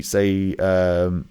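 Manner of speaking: ordinary talk, nothing marked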